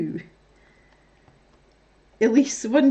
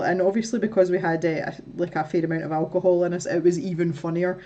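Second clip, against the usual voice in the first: normal talking voice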